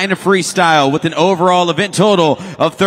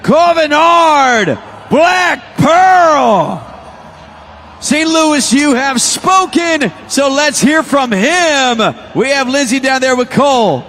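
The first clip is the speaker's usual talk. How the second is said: excited arena announcer call; 8 semitones higher, slower than usual. Real, not acted. Announcer calling the winner to the Saint Louis crowd, hyped. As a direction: build it up and boom the result to the crowd.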